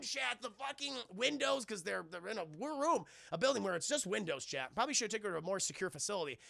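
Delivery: ordinary talk, nothing marked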